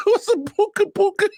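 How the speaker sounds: high, giggling through the words; laughing question. Real, not acted. Co-host cracking up at the made-up word, laughing. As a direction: ask it while cracking up.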